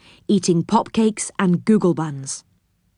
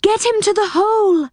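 high, urgent cry; shrieky high-pitched command; 13 semitones higher, 3 dB louder than usual. A narrator's reading. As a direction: cry out an order in alarm. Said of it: "cried Silky".

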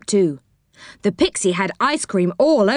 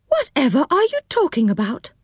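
higher-pitched innocent puzzlement; feigned innocence; 8 semitones higher than usual. A narrator's reading. Culprit to angry callers, pretending not to know. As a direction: act baffled, lifting the pitch. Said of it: "pretending not to know".

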